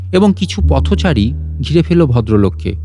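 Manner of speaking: ordinary delivery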